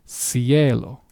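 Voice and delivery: cielo slowly, the C said as S; soft C. Shown as a demonstration. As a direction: open cielo with an s sound. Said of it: "has the S sound".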